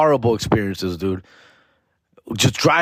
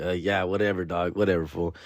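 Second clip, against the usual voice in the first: lower, softer dismissive mumble; bored brush-off; 7 semitones lower, 8 dB quieter than usual. Retold or imitated. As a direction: wave him off, totally unimpressed. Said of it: "People like".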